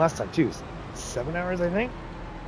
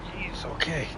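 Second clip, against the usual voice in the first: whispered aside under breath; 11 dB quieter than usual. Real, not acted. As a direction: breathe it out unvoiced. Muttered aside.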